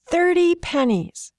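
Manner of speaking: put-on high, slow little girl's demand; a small girl holding out for payment, pitched high; demanding child. Little girl demanding payment from Zanja, high and slow. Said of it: "she demanded".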